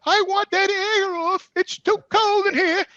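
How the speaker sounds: put-on high, slow cranky old lady. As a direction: drag it out like a stubborn old roommate.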